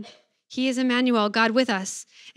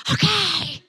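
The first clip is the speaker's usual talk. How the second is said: put-on high, hushed-but-loud little kid; loud kid whisper; 7 semitones higher than usual. Child answering mom's order to whisper, in a loud kid whisper. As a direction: mimic a child's far too loud whisper. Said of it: "when little kids whisper because it's actually louder".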